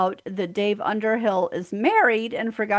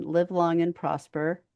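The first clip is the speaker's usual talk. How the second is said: ordinary delivery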